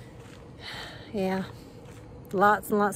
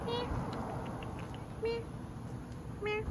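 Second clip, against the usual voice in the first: meowing call; 12 semitones higher, 12 dB quieter than usual. Real, not acted. Calling cats with meows.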